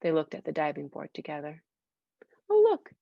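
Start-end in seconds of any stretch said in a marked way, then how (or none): 2.5-2.9 excited oh look. Real, not acted. Teacher exclaims over picture.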